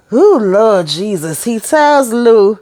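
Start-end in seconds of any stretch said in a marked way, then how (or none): 0.1-1.4 exasperated sigh. Real, not acted. Exasperated aside mid-recap.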